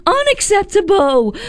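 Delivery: high-pitched scolding mom voice. Retold or imitated. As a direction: play a strict Singaporean mom telling off her kid over homework. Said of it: "Let me try".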